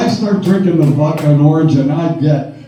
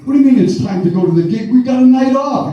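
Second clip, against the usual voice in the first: incredulous protest; 9 semitones higher, faster than usual. Retold or imitated. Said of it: "retorting to tour manager".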